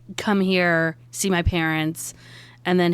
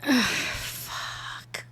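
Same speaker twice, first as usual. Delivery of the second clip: sighed out in a near-whisper; whispered in defeat; dejected sigh; 5 semitones higher, 6 dB quieter than usual. Acted. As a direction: let the curse out on a heavy, soft breath. Bad news for her character.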